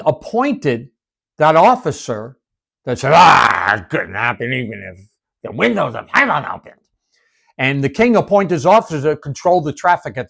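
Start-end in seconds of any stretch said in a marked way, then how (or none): 3.1-6.8 mocking scoff. Officer mocking Elijah's prophecy.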